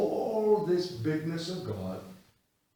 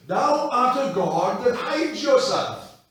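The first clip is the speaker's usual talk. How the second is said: loud, slow, declaimed line; booming quoted verse; loud preached quote; 7 semitones higher, 13 dB louder than usual. Retold or imitated. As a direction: proclaim it from the pulpit. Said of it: "remember W J Morgan preaching this".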